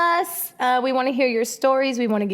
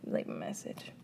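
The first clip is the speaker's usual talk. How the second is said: whispered aside; 16 dB quieter than usual. Pretend. Acting out answering random calls.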